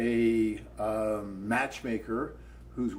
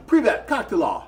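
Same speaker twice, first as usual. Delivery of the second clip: loud, higher-pitched hello in Russian; acted Russian greeting; 8 semitones higher, 10 dB louder than usual. Man greeting women at a table in Russian, loud and high. Acts out his greeting at socials.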